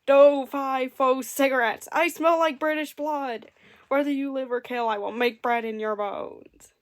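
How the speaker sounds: loud, slow giant bellow; booming giant chant; explosive angry giant. A narrator's reading. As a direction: blast it out like a furious giant. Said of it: "made a very explosive and angry voice".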